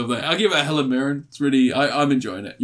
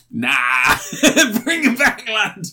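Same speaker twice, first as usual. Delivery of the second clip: mock laddish voice; 9 semitones higher, 4 dB louder than usual. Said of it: "Endgame's like".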